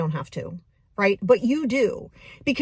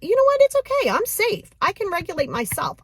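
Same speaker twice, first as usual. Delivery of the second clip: reassuring self-talk; 11 semitones higher than usual. Shown as a demonstration. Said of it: "teach yourself that".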